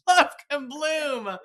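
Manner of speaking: bursting into a laugh mid-phrase. Real, not acted. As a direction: giggle out the phrase, slowly.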